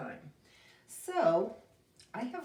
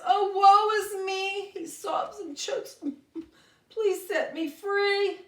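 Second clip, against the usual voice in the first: high, loud hammed-up lament; mock wailing goat; 10 semitones higher, 8 dB louder than usual. Scared goat begs the trolls, high loud mock wailing. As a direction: wail and beg to be set free. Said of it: "Joey cries".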